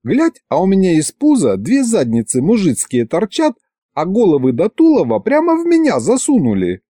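high-pitched complaining wife; indignant wife. A narrator's reading. Wife complaining about her belly to husband and sorcerers, indignant.

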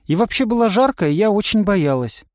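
put-on high, defensive girl's voice; girl's excuse. A narrator's reading. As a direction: plead your case. Настя оправдывается. (высоко, оправдываясь)

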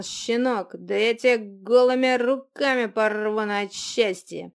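loud, slow enraged troll roar; furious shouting. Acted. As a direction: bellow with drunken outrage. Troll demands the bottle back.

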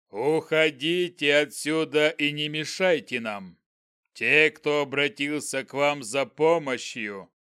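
slow booming goblin voice; menacing giant goblin, drawn out; dark lord. Acted. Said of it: "громогласно произнёс он".

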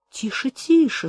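ordinary delivery

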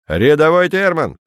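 sharp barked name. A narrator's reading. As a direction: bark the name out. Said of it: "рявкнул сержант".

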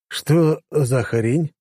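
slow, stunned question; drawn out in disbelief; surprised. A narrator's reading. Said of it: "удивился я".

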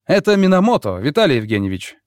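loud high shout. A narrator's reading. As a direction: call out through the door. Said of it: "крикнул я".

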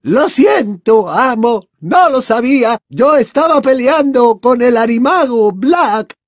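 high, loud, wailing apology; Bellatrix whimpering. A narrator's reading. Bellatrix excusing herself to Voldemort, whimpering. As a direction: plead shrilly with your master. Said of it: "gimoteó Bellatrix".

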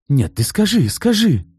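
high, loud insistent demand; rounding on her son; pouncing demand. A narrator's reading. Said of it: "налетела на сына Валентина Степановна". (напористо, высоко и громко)

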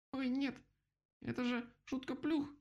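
very high little-animal voice; exclaiming vole. A narrator's reading. Vole pops up at the clearing, exclaiming. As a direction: squeal it as a tiny excitable creature. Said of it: "воскликнула взявшаяся непонятно откуда полевка".

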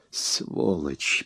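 low, soft curse forced through gritted teeth. Acted. Thug curses the hero, low and through gritted teeth. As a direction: spit the insult out quietly between clenched teeth. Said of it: "процедил он сквозь зубы".

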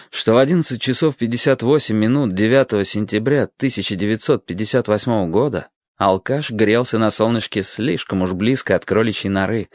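ordinary delivery, nothing marked

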